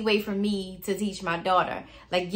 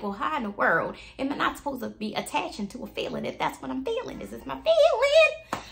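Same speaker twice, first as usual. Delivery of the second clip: high-pitched, quick, baffled protest; exasperated protest; 6 semitones higher than usual. Retold or imitated. Client protesting to her therapist, comically exasperated.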